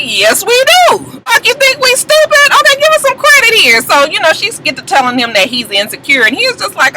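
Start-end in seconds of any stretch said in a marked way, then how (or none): 0.0-3.8 high-pitched, incredulous comeback; high indignant retort; mock indignation. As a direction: talk back to him, voice pitched way up. Mock indignant reply to Nico.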